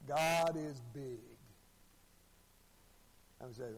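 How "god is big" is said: very slow, drawn-out proclamation; imitated preacher. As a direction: stretch each word like a preacher opening his sermon. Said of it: "the preacher gets up there".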